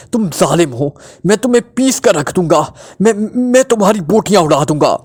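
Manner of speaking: angry outburst, pitch jumping up; screaming threat. A narrator's reading. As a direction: cry out threats, stumbling with fury. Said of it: "टिकोरा ने चीखते हुए कहा".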